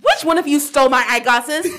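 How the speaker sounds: hammed-up exasperated parent; frantic parent. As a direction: demand it of the kids like an annoyed parent. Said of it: "A parent looking for their sunglasses".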